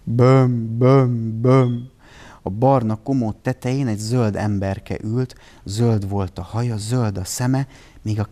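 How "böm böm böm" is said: a loud, blubbering boo-hoo; a little green man bawling his heart out. A narrator's reading. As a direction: wail each syllable like a crying child. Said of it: "hallani lehetett a bömbölést".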